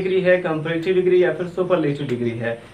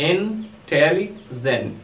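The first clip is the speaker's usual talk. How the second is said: slow syllable-by-syllable; much slower than usual. Shown as a demonstration. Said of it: "तीन syllable आए हुए हैं".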